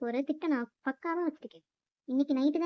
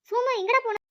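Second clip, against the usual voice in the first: put-on high, raised call for a child; higher-pitched searching call; 9 semitones higher, 4 dB louder than usual. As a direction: call out to find your missing son. Father calls out for missing son.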